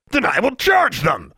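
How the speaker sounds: furious official. Acted. Inspector threatens to charge the students, furious and loud. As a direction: threaten them in a rage.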